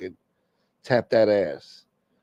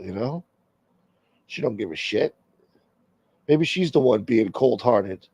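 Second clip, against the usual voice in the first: ordinary talk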